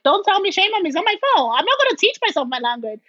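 high-pitched, protesting comeback; indignant retort. Retold or imitated. Answering friends who shame her over her language, indignant.

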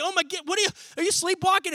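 high-pitched, flustered disbelief; mock shocked parent; startled parent. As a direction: question a sleepwalking child in alarm. Parent finds child sleepwalking.

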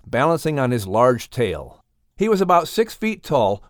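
plain reading voice

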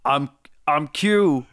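slow, lightly voiced take on his cohost; impression of host. Friend attempts the host's voice on request. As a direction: slowly try out his friend's throaty voice. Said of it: "Do an impression of me".

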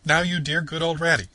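high, loud pleading coax; imploring. A narrator's reading. Toad begging Rat to come along, imploring loudly. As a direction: beg him sweetly but eagerly. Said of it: "said Toad imploringly".

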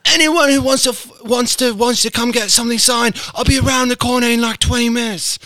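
high-pitched mock English accent. Imitating the rapper's English accent to his co-host. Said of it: "[name] was like".

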